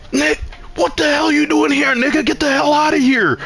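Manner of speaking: hammed-up shocked shout at the door; mock outraged yell. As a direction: shoo him off in fake fury. Yelling at friend at door.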